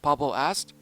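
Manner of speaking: ordinary delivery, nothing marked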